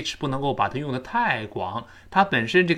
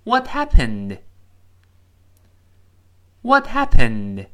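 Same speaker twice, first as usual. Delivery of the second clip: loud, falling "What happened" for repetition; big, clear teacher's model with falling tune; loud model reading; 10 dB louder, slower than usual. Shown as a demonstration. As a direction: read it out loud for the class, both words stressed. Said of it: "跟老师大声读两遍".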